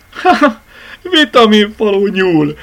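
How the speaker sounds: high, loud acted laugh into words. A narrator's reading. Sick child Bogi amused by the rabbit, laughing. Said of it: "nevetett Bogi".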